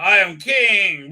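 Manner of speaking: slow, loud pompous proclamation; mock king. Mocking the king with a loud pompous proclamation. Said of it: "he goes".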